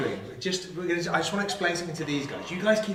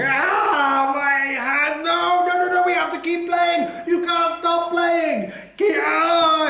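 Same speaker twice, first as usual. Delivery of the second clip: high, loud, frantic cry of pain; pained pleading; 11 semitones higher, 4 dB louder, slower than usual. Acted. Desperate golfer with a broken hand begs them to keep playing, high frantic cry. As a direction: yelp through a broken hand, begging.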